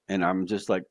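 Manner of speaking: usual speaking voice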